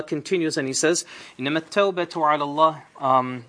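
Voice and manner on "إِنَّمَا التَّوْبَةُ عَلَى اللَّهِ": fast melodic Quranic recitation; تلاوة القرآن بسرعة. Acted. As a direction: recite the verse in Arabic, fast and flowing.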